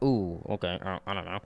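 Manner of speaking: mock sheepish backing off; caught-out Doctor. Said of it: "the Doctor's like".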